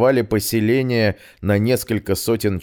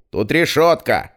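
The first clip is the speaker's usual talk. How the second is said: loud call from below; shout; 7 semitones higher, 4 dB louder than usual. Acted. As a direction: call up the news loudly. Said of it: "он крикнул". (громкий крик)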